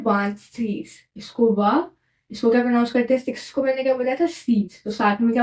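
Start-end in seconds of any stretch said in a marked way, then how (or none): 0.0-0.9 वांत सीज as "vant" plus "seess"; French pronunciation. Shown as a demonstration. Teacher modelling twenty-six in French, वांत सीज pronounced the French way. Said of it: "क्या बोलते हैं?".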